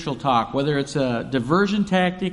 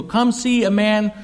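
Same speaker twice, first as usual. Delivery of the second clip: higher and louder, eager calling out; excited announcement; 8 semitones higher, 5 dB louder than usual. Retold or imitated. Woman running to tell the town, excited and loud.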